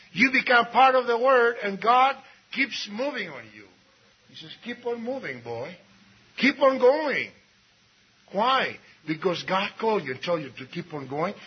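normal talking voice

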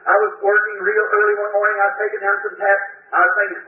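usual speaking voice

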